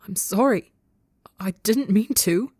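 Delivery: beg, voice pitching up and wavering; begging. A narrator's reading. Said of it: "Tubbo begs".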